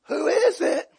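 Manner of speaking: imitated householder. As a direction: mimic someone asking who's there. Householder calls again through door.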